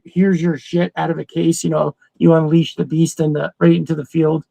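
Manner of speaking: normal talking voice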